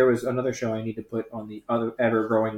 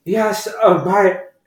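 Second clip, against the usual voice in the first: higher and louder, slurring tipsy rant; drunk woman impression; 5 semitones higher, 5 dB louder than usual. Drunk customer complaining about her old boss, loud tipsy rant. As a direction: lurch through it like a drunk ranting. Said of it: "she was like".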